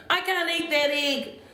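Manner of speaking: high-pitched childish wail, lightly acted; mock crying complaint. A narrator's reading. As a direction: protest like an upset child in a storybook. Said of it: "cried and cried and said".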